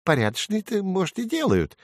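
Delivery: high, piqued sparrow voice; high-pitched, miffed bird; angry sparrow. A narrator's reading. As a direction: retort as a cross little bird. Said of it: "рассердился воробей".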